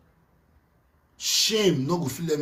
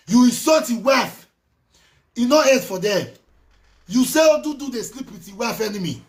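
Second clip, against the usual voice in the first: angry; 6 semitones higher, 5 dB louder than usual. Real, not acted. Angry rant at Atiti.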